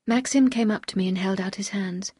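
usual speaking voice

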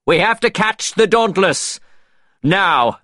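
high, forceful order to the crew; rally the sailors, pitched well up; urgent command. A narrator's reading. Norrington orders sailors to give chase.

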